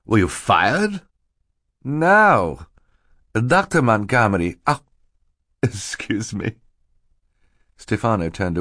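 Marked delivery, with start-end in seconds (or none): 0.0-1.0 higher, rising question; stern questioning. A narrator's reading. Mister Poe interrogates Stefano, sternly. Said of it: "asked sternly". 1.8-6.6 faltering, breaking off in mock sorrow; feigned grief. A narrator's reading. Stefano pretends grief before Mister Poe, faltering. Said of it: "as if he were too sad to continue".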